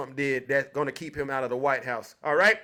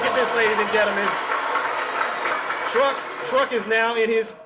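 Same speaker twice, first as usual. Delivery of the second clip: loud, higher, gleeful showman teasing; mocking; 4 semitones higher, 3 dB louder than usual. Real, not acted. Telling the ladies and gentlemen Truck is in his feelings, gleeful. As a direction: call out the crowd to laugh at him. Host mocking upset commenter.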